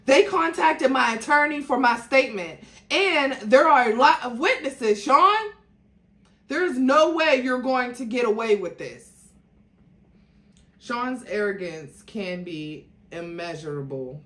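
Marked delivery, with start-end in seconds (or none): 0.1-9.1 louder and higher, pressing hard; rising alarm, keep pushing him; agitated, not calming down. Acted. Jennifer warning Sean about witnesses, loud and agitated. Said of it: "Jennifer was not calming down".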